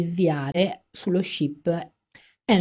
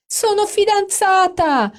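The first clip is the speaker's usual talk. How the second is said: 1 thrilled announcement; 7 dB louder, slower than usual. Acted. Amanda announces engagement.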